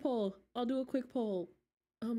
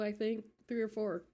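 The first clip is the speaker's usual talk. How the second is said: ordinary talk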